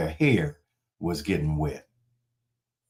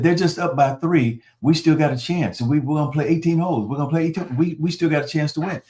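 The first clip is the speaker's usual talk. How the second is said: higher, faster, anxious insisting voice; anxious friend; 6 semitones higher, faster than usual. Retold or imitated. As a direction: talk yourself into hope, rushing higher. David anxious, voiced while losing.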